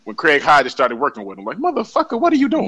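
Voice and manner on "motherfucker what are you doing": high-pitched mock incredulity; retold exasperated question, pitched way up; incredulous coach. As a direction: imitate a coach baffled by his player. Acted quote of coach scolding player.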